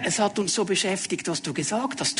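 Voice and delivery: soft, subdued excuse to the Lord; verlegene Ausrede der Jünger. Acted. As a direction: answer Jesus quietly, voice sinking. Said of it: "wir würden jetzt ja sagen". (leise, kleinlaute Ausrede)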